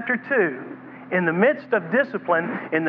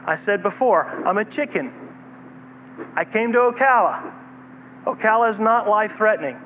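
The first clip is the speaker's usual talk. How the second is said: ordinary delivery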